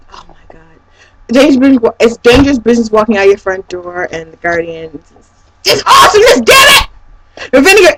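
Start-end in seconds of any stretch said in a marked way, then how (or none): 0.1-0.8 very soft, whispered swoon; awed whisper. Real, not acted. Fan recalling favourite songs, awed whisper. 5.6-6.9 high excited outburst; overwhelmed excitement. Real, not acted. Fan raving about the band's songs, excited high outburst.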